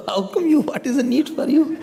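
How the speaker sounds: high, amused questions through laughter; real laughter breaking into the words; laughing. Real, not acted. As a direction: ask it while cracking up. Teacher cracking up while teasing a listener.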